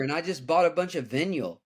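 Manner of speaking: "vinyl" with a short i, imitating a friend's mistake; mispronounced vinyl. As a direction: quote the friend, saying "vinyl" as VIN-yul. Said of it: "He pronounced vinyl".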